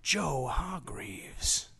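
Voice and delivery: very slow trailer whisper; movie trailer voice. Pretend. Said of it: "nineties movie trailer voice".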